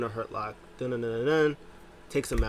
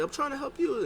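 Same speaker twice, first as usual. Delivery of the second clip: much higher, whiny defence; mock whiny defence. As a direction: plead innocence, hammed-up. Partner defends himself.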